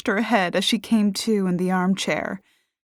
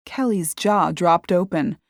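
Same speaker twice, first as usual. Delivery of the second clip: ordinary delivery, nothing marked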